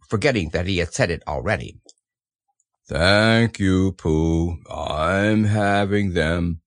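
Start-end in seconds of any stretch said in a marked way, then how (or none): 2.9-6.6 low, slow, drawn-out gloom; gloomy donkey drone; gloomy Eeyore. A narrator's reading. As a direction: drag it out with heavy resignation. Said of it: "said Eeyore gloomily".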